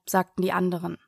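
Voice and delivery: plain reading voice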